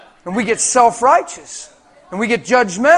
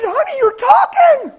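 high, loud, amazed outburst; acted, excited squeal of wonder; astonished wife; 7 dB louder than usual. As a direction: imitate a wife crying out in disbelief. Wife astonished her husband talks.

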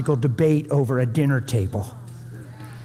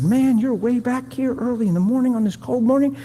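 much higher-pitched friendly amazement; amazed greeting; 9 semitones higher than usual. Retold or imitated. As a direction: call out in wonder to a passing hiker. Greets a hiker in retold story.

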